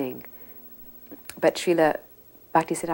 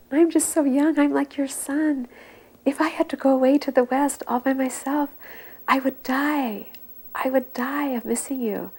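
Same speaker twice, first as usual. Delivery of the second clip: weeping plea; 9 semitones higher, 4 dB louder than usual. Retold or imitated. Weeping disciple begging his guru not to send him, high and tearful. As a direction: beg through tears like a little boy. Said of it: "he began to weep".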